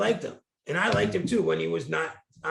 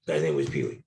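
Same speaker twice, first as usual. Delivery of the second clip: ordinary talk, nothing marked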